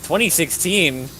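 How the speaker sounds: mock disbelief at the year; incredulous. Real, not acted. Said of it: "What's with that twenty sixteen voice?".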